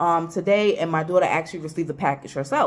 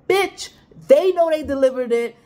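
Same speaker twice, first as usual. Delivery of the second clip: higher-pitched, exasperated comeback; indignant retort; 7 semitones higher, 4 dB louder than usual. Retold or imitated. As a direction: fire back in disbelief, retelling the clash. Retold retort to the neighbor.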